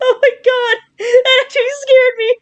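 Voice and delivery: high, loud frightened laughter; startled and laughing at the scare; scared laugh. Real, not acted.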